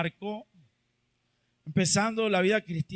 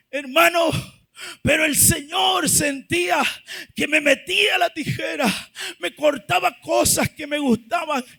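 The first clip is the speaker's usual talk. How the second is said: raised, loud, building preacher voice; full-force preaching; 5 semitones higher, 11 dB louder than usual. Real, not acted. Preacher telling the church they are called to serve, full-force preaching. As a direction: drive each phrase out, stretching the key words.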